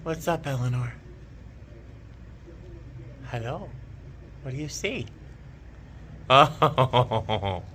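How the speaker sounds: hushed, tender voice for the cat; soft pet talk. Real, not acted. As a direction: coo gently at the cat. Talking softly to her cat.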